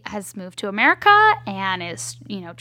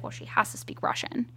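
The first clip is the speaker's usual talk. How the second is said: whispered thought; 9 dB quieter than usual. Retold or imitated. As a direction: whisper "Well, she has to speak Russian" as a private realization. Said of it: "And I was like".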